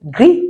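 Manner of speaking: "gris" said GREE, the final s silent; French gris. Shown as a demonstration. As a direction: say "gris" with a long ee and no s. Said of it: "I told you already that I sounds E".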